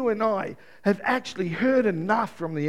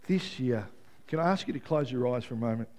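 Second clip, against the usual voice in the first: soft, low altar-call voice over music; hushed closing prayer voice; quiet prayer ministry; 10 semitones lower, 6 dB quieter than usual. Acted. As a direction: lead them gently into prayer. Prayer ministry, eyes closed.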